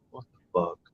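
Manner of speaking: stunned disbelief. Retold or imitated. Father hearing the pregnancy news, stunned and hushed. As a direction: breathe it out, floored by the news.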